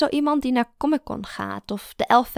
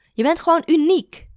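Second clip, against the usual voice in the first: higher and louder, insisting; emphatic compliment; 8 semitones higher, 8 dB louder than usual. A narrator's reading. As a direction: press the compliment on her. Mara insists warmly.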